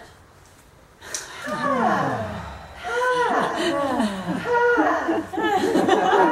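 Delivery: loud, high, laughing sighs; big sighs. Shown as a demonstration. Teacher leading the class in loud playful sighs. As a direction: overdo the sighing for fun. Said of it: "let's sigh a whole bunch".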